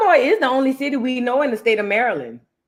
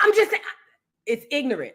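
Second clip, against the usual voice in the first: ordinary talk, nothing marked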